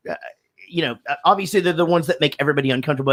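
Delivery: usual speaking voice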